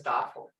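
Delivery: "duffel" said as dah-fel; wrong long vowel. Shown as a demonstration. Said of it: "there's no such word as".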